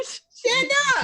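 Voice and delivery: high-pitched frantic plea; panicked urging. Acted. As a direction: squeal it at a fallen friend in panic. Terrified Timmy urging fallen Paul.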